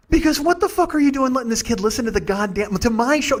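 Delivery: high, indignant scolding; incredulous outrage. Retold or imitated. Comic appalled a kid hears his show, high indignant outrage.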